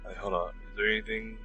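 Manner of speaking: ordinary talk, nothing marked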